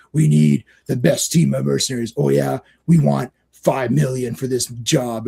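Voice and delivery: lightly voiced mercenaries haggling. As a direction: play the greedy hired gun. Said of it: "it's like".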